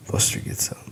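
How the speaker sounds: ordinary delivery, nothing marked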